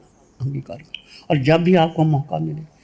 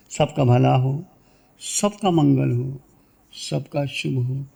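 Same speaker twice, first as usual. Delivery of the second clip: low, calm spoken benediction; meditative blessing; 3 semitones lower, 5 dB quieter, slower than usual. Real, not acted. Teacher wishing everyone well after the chant, low meditative blessing. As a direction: bless them gently in a lowered voice.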